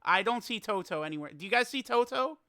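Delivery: ordinary talk, nothing marked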